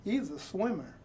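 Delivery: usual speaking voice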